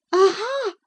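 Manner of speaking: high triumphant Aha; cry of triumph. Acted. Cathy to Ellen, high triumphant cry. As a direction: pounce on it, high. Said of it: "she cried".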